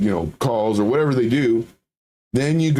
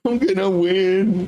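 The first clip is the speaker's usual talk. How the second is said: high, giddy mock glee; drawn-out, pitched-up giddy gloat; a little giddy; 9 semitones higher, much slower than usual. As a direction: act out a card player who can't hide delight. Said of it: "start getting a little giddy".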